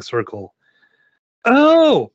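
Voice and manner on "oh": high, loud, drawn-out gasp of wonder; awed girl. A narrator's reading. Girl catching sight of mama's ring, high awe. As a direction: let a child's delighted "oh" soar. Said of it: "Mary whispers".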